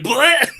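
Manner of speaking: high silly death yelp; cartoonish death bleh; mock death cry. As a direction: ham up a goofy dying grunt. Imitating dying cameo character.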